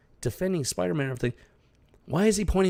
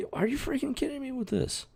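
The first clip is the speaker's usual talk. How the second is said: high, exasperated disbelief; incredulous; 13 semitones higher than usual. Real, not acted. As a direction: pitch it up in outraged disbelief. Outraged at the comic's writing.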